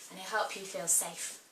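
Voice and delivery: ordinary talk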